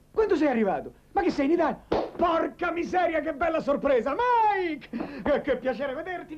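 loud, high, overjoyed greeting; delighted surprise. Acted. Man greeting his long-unseen friend, delighted surprise. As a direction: burst out laughing with joy at the visitor.

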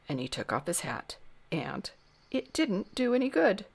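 plain reading voice

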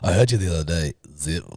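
ordinary talk, nothing marked

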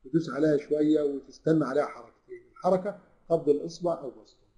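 ordinary talk, nothing marked

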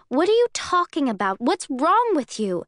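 loud confused outrage at his laughter; angry demand. Acted. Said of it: "I demand".

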